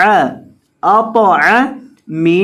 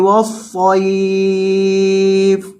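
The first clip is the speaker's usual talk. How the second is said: slow recited والصیف stopping on a silent fa; Quran recitation; much slower than usual. Shown as a demonstration. Teacher reciting the verse for the class, slow chant. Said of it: "وقف کریں گے".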